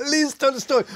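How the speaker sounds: high, long burst of laughter into the words; laughing. Real, not acted. Guest retelling Kissinger's reply to the interviewer, laughing hard. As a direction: crack up while quoting the punchline.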